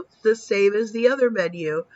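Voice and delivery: normal talking voice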